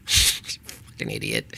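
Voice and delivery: muttered insult. Real, not acted.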